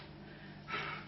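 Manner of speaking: slow soft sigh out; long audible exhale. Shown as a demonstration. Hypnotist demonstrating a calming out-breath. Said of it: "watch me, the way I breathe".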